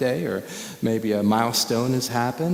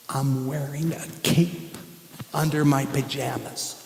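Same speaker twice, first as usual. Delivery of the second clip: put-on soft, slower kid secret; secret confession; 5 dB quieter than usual. Little boy telling his cape secret, softly and slowly. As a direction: let him in on it quietly. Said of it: "He wants to tell me a secret".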